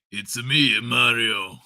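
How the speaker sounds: put-on low, tired old game-plumber voice; aged Mario. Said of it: "Sounds more like".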